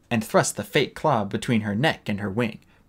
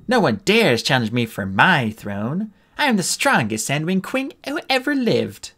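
pompous queen; 6 semitones higher than usual. Pretend. Sunny pretending to be queen for friends, hammed-up pompous. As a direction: play a grand queen badly on purpose. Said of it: "Sunny went on".

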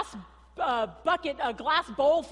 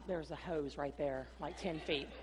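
quiet deadpan; 8 semitones lower, 9 dB quieter than usual. Retold or imitated. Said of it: "And he goes".

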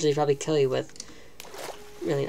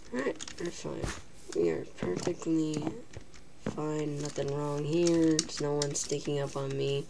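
much softer, slow uneasy murmur; nervous hush; 10 dB quieter than usual. Real, not acted. Scared gamer alone in the quiet, murmuring softly. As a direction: murmur reassurance to yourself while scared. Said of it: "I'm very scared".

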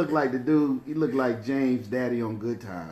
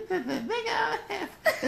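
high mocking voice. Quoting line.

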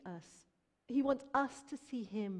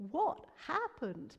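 higher, slow, stretched-out amazement; astonished doctor; 7 semitones higher, 4 dB louder, much slower than usual. Retold or imitated. Doctors looking at a now healthy child, astonished. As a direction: draw it out in amazed disbelief. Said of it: "doctors look at James and go".

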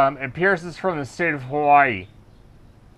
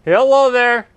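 louder raised call; 5 semitones higher, 4 dB louder than usual. Real, not acted. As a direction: call up toward the house. Calling out at a house.

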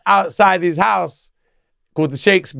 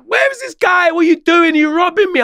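mock impatient buyer, pitched much higher; agitated, accusing, voice jumping up high; going mad; 14 semitones higher than usual. Buyer waiting round the corner, impatiently accusing him in a high mock rant. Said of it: "He's going mad now".

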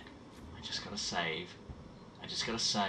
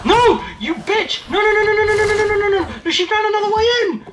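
very high, loud terrified screams; panicked screaming; 18 dB louder than usual. Real, not acted. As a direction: shriek in pure panic. Monster finds way in.

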